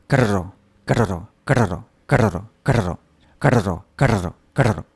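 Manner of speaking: "carro" repetido, la R sale como varios toques, ka-ro-ro; práctica de la R. Shown as a demonstration. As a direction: repite "carro" intentando hacer vibrar la punta de la lengua. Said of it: "este es mi resultado hasta ahora". ("carro" practicando la erre vibrante)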